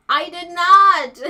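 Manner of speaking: surprised. Real, not acted. Realizes she never asked YouTube.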